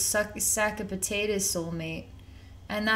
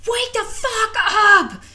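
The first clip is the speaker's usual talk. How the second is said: much higher, much louder, slow shout breaking into a laugh; 14 semitones higher, 11 dB louder, slower than usual. Retold or imitated. Client shouting at the man dragging her, half laughing. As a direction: bellow it to jolt him awake. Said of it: "you shout at him the words".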